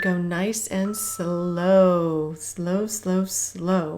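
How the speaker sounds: long drawn-out, easing-off words; slow coaching cue. Real, not acted. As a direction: stretch each "slow" to ease the pace. Coach easing exercisers into slow interval.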